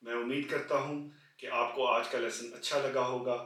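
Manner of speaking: quiet sign-off asking viewers to share; soft outro. Acted. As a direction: wind down the lesson gently.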